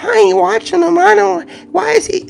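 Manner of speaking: very high falsetto mimicry of readers; exaggerated high-pitched mock protest; mocking slick readers. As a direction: put on a squeaky-high mocking voice for their denial. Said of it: "real slick like y'all like to do".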